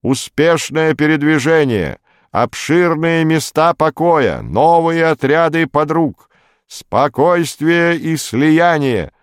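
high, loud, slow metallic drone. A narrator's reading. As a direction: drone it like a machine in a trance. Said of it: "металлическим голосом бредил слухач".

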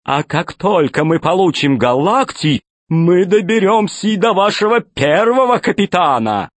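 high, slow jolly villain; fat pirate voice. Acted. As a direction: play the grinning pirate boss. Fat pirate Tolstyak speaks.

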